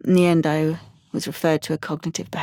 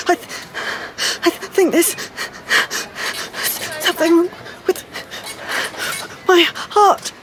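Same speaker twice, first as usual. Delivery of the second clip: high, breathless words broken by gasps; panicked gasping; 10 semitones higher than usual. Acted. Telling Mum something is wrong with the heart, panicked and gasping. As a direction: struggle to get each phrase out between panting breaths.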